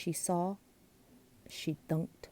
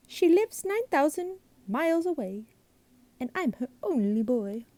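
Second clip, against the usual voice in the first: high sing-song monster voice, cheeky; cheeky glunk; 7 semitones higher than usual. A narrator's reading. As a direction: play the glunk as a merry pest. Glunk figure in story.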